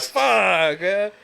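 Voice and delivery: loud, high drawn-out outburst; exclaimed frustration. Retold or imitated. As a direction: blurt it out big, pitch way up. Acted reaction to breakfast naked offer.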